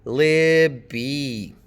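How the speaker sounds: "Libby" slowly split, LIB then bee; first-syllable stress. Shown as a demonstration. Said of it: "stress is on the first syllable".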